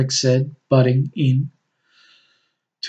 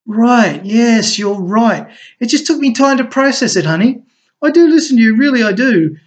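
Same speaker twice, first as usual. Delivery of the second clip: high, louder eager backpedalling; over-eager apology to smooth it over; placating husband; 10 semitones higher, 6 dB louder than usual. A narrator's reading. Rex hurriedly placating Crystal after her jab, higher and louder.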